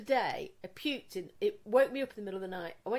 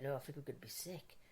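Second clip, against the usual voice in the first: queasy and quiet; 7 semitones lower, 14 dB quieter than usual. Retold or imitated. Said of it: "I went".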